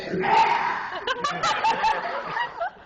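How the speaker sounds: loud, high shrieking cackle after trying the voice; shrieking villain cackle. Acted. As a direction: let out a wild, screechy cartoon-villain laugh. Performs Garlic Junior voice, laughs.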